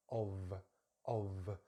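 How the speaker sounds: "of" ending in a voiced V, not F; slow, isolated "of" with a clear V; V sound in "of". Shown as a demonstration. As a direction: say "of" with a buzzing V at the end. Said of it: "it's actually a V, a V, V sound".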